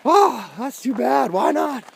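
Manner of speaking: friend's mock concern, slowed down; slow sympathetic friend. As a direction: drag out the pity in a high voice. Friend responds, retold.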